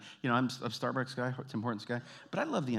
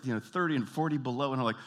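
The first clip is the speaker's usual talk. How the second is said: usual speaking voice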